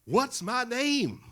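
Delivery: high, loud champion's challenge; Muhammad Ali. Acted. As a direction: demand it, stretching the last words. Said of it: "Ali tries it again".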